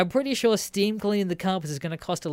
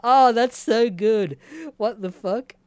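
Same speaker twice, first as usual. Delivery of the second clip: still laughing; 6 semitones higher, 8 dB louder, slower than usual. Real, not acted. After big laugh.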